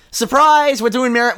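loud, high excited reveal. Real, not acted. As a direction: burst out with the surprise. Surprise announcement of next franchise.

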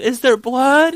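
high, loud rising alarm; imitated child panic. Five-year-old realizing she is bleeding, imitated panic. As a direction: shoot up in pitch like a panicking kid. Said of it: "then she just loses it".

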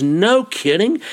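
retold amazed question, high rise; excited. Newcomer hears about canyon and ferns, excited. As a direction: react with eager, rising disbelief. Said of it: "And he was so excited".

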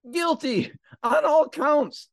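high, loud, slow proclamation; dramatic verdict. Retold or imitated. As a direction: announce the verdict with drawn-out drama. Announcing the jury's verdict dramatically.

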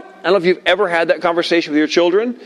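ordinary talk, nothing marked

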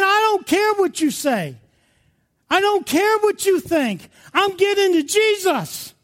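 high, defiant cry; impassioned, high-pitched acted yell; yelling to God. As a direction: yell past the people hushing you. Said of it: "He's yelling to God".

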